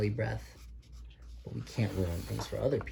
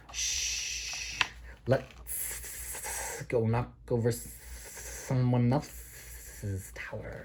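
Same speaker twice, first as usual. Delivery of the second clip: slow, hushed hissing snake; slow sly whisper; sneaky snake; 2 semitones higher, much slower than usual. Acted. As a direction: tempt them in secret.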